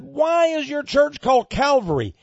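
high, loud pulpit challenge; challenging shout. Retold or imitated. Preacher retelling his question to a pastor, challenging shout.

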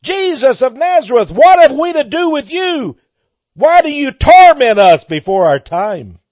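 devils crying out. Retold or imitated. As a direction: cry out as the tormented devils. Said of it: "and the devils cried out".

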